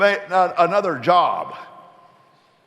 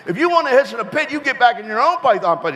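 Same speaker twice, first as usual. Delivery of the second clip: put-on high-pitched scolding old lady, a touch of laughter; Missus Pot; 8 semitones higher, 3 dB louder, faster than usual. Neighbour snake scolding young Johnny, high and indignant. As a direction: squeak it out like a fussy neighbour.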